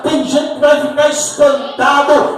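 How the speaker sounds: high, loud, driving sermon delivery; full-force preaching. Real, not acted. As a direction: preach it at full force, building to the last line.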